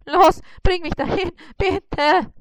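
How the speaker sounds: high, loud begging; high pleading spider. Acted. Spider begs Bissi to take him to the dentist, high.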